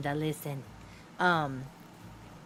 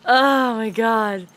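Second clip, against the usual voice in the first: loud, slow, gasping through the laughter; laughing; 10 dB louder, much slower than usual. Real, not acted. Still laughing over the gummies story, loud gasp.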